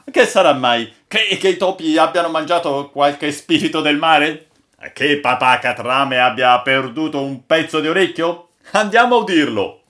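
high, loud crew chatter; excited exclaiming. A narrator's reading. Crew speculating about the old sailor's tale, exclaiming excitedly.

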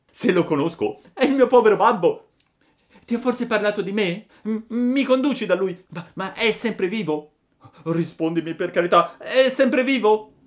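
high, loud, agitated puppet voice; desperate child begging for news; urgent pleading. A narrator's reading. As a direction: stammer out anxious questions, building to a cry. Puppet begs news of his father.

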